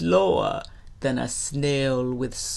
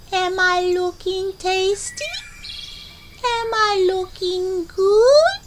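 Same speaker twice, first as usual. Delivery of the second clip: teasing possum; 4 dB louder than usual. Acted. Possum taunts gator just out of reach, high and coy. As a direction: dangle each question teasingly, rising at the end. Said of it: "she calls as she swings".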